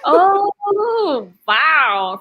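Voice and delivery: high, loud, amazed exclamation. Real, not acted. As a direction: react with a big burst of surprise. Reacting to a surprising answer.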